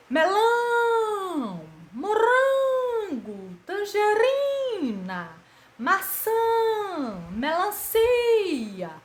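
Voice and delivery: slow, high, drawn-out street-market cry; high stretched vendor's call. Shown as a demonstration. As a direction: call out the fruit to shoppers at the fair. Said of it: "ouvindo alguém chamar a atenção dos fregueses".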